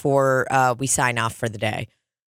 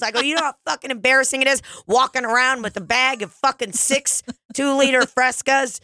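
mock indignant rant; 9 semitones higher than usual. To the roasting bar crowd, higher mock rant. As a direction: rant like the whole bar's against you. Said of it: "I'm like".

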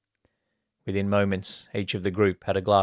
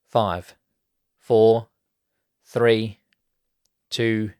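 counting down; 2 semitones higher, 6 dB louder, much slower than usual. A narrator's reading. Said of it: "They all joined the countdown".

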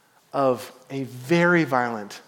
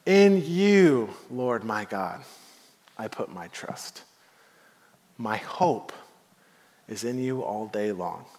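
softer and lower, fading to a hush; a slow, humble prayer, dying away; quiet prayer; 6 semitones lower, 5 dB quieter, slower than usual. Acted. David's daily prayer to God, softer and fading.

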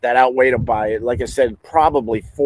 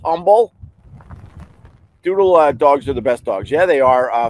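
ordinary delivery

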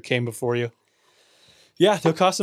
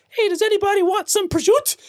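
very high mock Italian-American mom; put-on high voice, dropping the last vowel of prosciutto; Carmela Soprano. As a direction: imitate a mom calling out, pitched way up. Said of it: "she sits down and is like".

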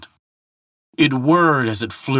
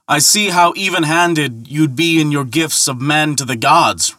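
retort; 7 semitones higher, 3 dB louder than usual. A narrator's reading. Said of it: "retorted Thor".

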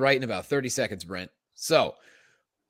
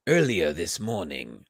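put-on botched Spanish accent that sounds British; low, slow try at an accent that slips into British; Spanish accent attempt; 9 semitones lower, slower than usual. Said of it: "No, that's definitely more British".

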